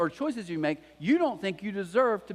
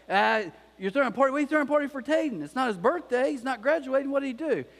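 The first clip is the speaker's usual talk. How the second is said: fast, much higher mock outrage; indignant parent; 7 semitones higher than usual. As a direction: play the scandalised parent firing questions. Mock outraged parent questioning party.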